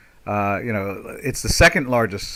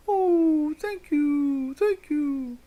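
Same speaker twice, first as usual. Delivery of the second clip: much higher, crying, put-on thankful voice; mock grateful whimper; 4 dB quieter, much slower than usual. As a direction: whimper thanks like a coddled child. Mock gratitude to nanny state.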